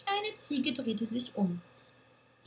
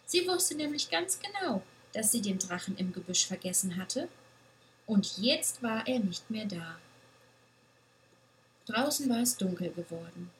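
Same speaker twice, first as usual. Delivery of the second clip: ordinary delivery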